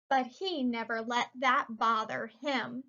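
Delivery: plain reading voice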